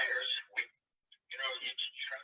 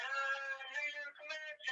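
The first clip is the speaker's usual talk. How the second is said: crowd cheer; 12 semitones higher, 4 dB quieter, much slower than usual. Retold or imitated. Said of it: "They said".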